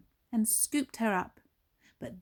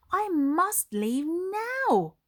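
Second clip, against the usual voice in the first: Jack thinking; 9 semitones higher, 5 dB louder, much slower than usual. Acted. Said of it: "he thought".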